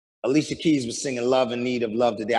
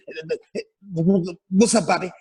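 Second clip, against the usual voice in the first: stuttered greeting, stuck on the first sound; imitated stutter; 3 semitones higher than usual. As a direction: mimic a man stuttering hello. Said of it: "He starts stuttering again".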